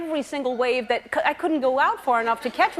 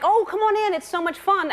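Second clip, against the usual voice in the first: high, lilting invitation; sing-song mock enthusiasm; imitated eager coaxing; 8 semitones higher than usual. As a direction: coax a friend in, playfully imitated. Friends coaxing her into the sea.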